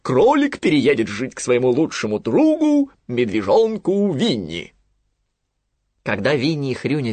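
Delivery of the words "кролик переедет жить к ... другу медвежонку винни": louder, raised owl decree; proclaiming Owl. Acted. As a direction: declare it settled like the owl. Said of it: "провозгласила сова". (торжественно провозглашает сова)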